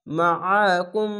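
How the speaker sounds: ordinary talk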